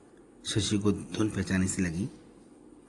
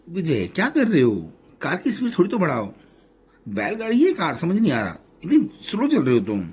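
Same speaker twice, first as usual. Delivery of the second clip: higher and louder, teasing complaint; exasperated nagging; 8 semitones higher, 5 dB louder than usual. A narrator's reading. Wife complaining to husband in the car, exasperated.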